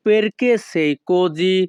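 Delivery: very slow, each sound drawn out; slow demo. Shown as a demonstration. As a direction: stretch it out for the learners. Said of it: "Italian ভাষা".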